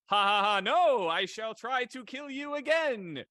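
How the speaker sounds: hammed-up evil refusal; a touch of sinister wizard; villain laugh. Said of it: "And I'm like".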